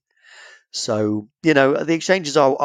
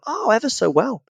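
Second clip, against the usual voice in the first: higher, plummy English understatement; mock posh English; 10 semitones higher than usual. Brit teasing an American about the royals, mock posh and higher. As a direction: play the aristocrat for the tourists.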